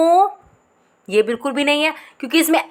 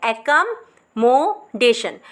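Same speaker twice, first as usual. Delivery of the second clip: Accommodation stretched very slowly; 2 semitones lower, much slower than usual. Shown as a demonstration. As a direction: stretch each part. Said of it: "अब देखो ये वाली situation". (बहुत धीरे)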